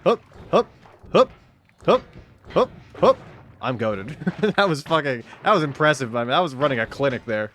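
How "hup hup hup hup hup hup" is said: short loud hup on each jump; rhythmic effort grunts. Real, not acted. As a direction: push out a hup with every leap. Jumping across platforms.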